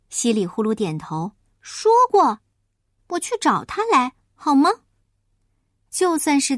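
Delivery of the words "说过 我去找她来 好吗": high, louder piglet voice; eager little pig. Acted. Little pig offers his mother to fetch Huahua, eager. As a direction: pipe up eagerly, pitched well above narration.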